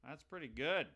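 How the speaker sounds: ordinary talk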